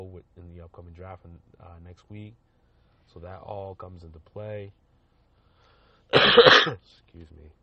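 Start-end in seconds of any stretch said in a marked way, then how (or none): 6.1-7.5 loud harsh coughing; coughing fit. Real, not acted. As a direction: cough hard, then excuse yourself. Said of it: "I'm coughing all over the".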